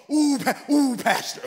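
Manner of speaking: mock a breathless eager helper; gushing new volunteer. Pastor imitating an overeager new volunteer, gushing.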